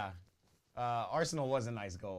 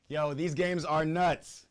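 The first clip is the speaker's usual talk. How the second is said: excited; 5 semitones higher, 9 dB louder than usual. Real, not acted. Reacting to another goal.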